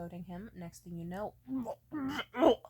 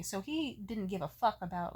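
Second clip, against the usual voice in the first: ordinary talk, nothing marked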